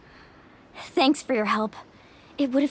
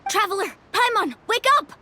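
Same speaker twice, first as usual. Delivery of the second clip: calling to wake them; 9 semitones higher than usual. Acted. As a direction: shout them awake from sleep. Waking sleepers amid monster attack.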